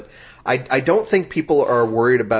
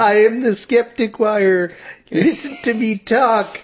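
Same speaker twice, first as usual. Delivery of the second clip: high, slow, strained proclamation; mock-grand announcing, pitched way up; lone host impression; 10 semitones higher than usual. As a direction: imitate a self-important host in a thin high voice. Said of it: "sitting here all on his own saying".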